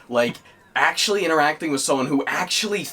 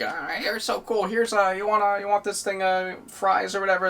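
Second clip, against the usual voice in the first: fawning fan; 8 semitones higher than usual. Retold or imitated. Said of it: "give them, like".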